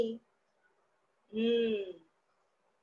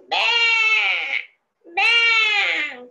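high, slow, loud bleating like a goat; 11 semitones higher, 6 dB louder, much slower than usual. Shown as a demonstration. Teacher bleating like a goat for children to guess, high and long. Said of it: "कोणता प्राणी असा ब्या, ब्या असं ओरडतो".

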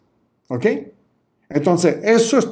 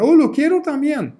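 much higher, delighted "Oh"; excited customer; 10 semitones higher than usual. Retold or imitated. Coach voices a customer grabbing a new offer, delighted. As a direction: squeal with delight at the new product.

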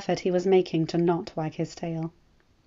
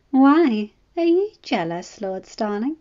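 playful teasing; 4 semitones higher, 6 dB louder, slower than usual. Acted. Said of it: "Her voice was playful".

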